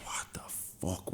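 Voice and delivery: hushed, slow whispered swearing. Retold or imitated. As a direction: whisper it under your breath, worried. Lost friend in dark club.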